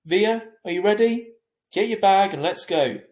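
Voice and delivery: calling out. A narrator's reading. Dad calling daughter.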